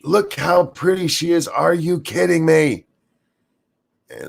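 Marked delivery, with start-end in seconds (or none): 0.0-2.9 high, gushing fangirl squeal; mocking gushing fan. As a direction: gush over the picture, mocking. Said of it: "repeat of caps quote".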